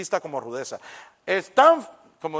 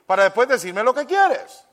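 louder, insistent demand for truth; blunt demand; 4 semitones higher, 9 dB louder than usual. Acted. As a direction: push them to just say it. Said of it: "le gusta". (exigiendo la verdad, más fuerte)

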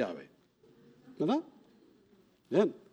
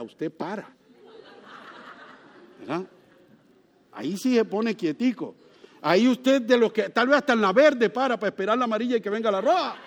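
ordinary delivery

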